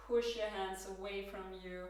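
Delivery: normal talking voice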